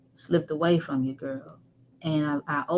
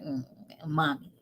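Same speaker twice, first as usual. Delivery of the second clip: mock grumbly little complaint; imitated grumbling; 7 dB quieter than usual. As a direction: mumble it softly like an irritated daughter. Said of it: "she'd grumble and be like".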